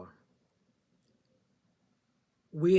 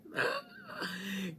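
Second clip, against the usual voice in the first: high laugh; 4 dB quieter than usual. Real, not acted. Laughs at herself.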